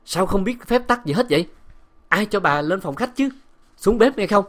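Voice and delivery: loud, high-pitched bawling out; furious master shouting orders; angry scolding. A narrator's reading. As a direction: berate the servant at full volume. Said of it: "tiếng bá Nam quát tháo".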